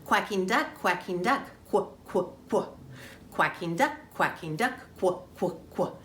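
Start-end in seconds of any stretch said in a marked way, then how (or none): 1.7-2.7 "quack" cut down to a short "kwuh"; quacking duck sound. Shown as a demonstration. Teacher modelling the duck sound for children, "quack" as short "kwuh". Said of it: "listening for our quacking duck sound". 5.0-5.9 "quack" cut down to a short "kwuh"; quacking duck sound. Shown as a demonstration. Teacher modelling the duck sound for children, "quack" as short "kwuh". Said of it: "listening for our quacking duck sound".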